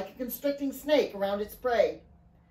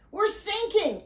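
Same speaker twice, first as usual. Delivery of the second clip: high-pitched alarm, falling at the end; panicked cry; 11 semitones higher, slower than usual. A narrator's reading. Alfie as water pours into the boat, panicked cry. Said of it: "Alfie cried".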